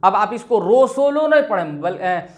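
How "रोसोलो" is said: रोसोलो with open 'o' in place of 'u'; majhul, wrong way. Shown as a demonstration. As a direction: turn every vowel to 'o' in रोसोलो. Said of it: "मजहूल और मारूफ के मुतालिक". (रोसोलो, मजहूल आवाज़ में)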